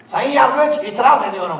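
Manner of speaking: hammed-up movie attorney; mock courtroom lawyer. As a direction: play the dramatic TV lawyer. Mock American courtroom objection.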